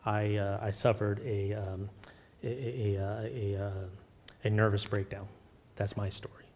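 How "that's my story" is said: whispered, the voice dropping away; hushed confession. Real, not acted. Pastor confessing his breakdown to the congregation, whispered. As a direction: let it slip out in a whisper.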